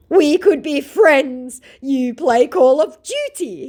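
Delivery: high, slow put-on voice of the scammer; mock scammer voice. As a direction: play him high and slow, wheedling. Voicing scammer.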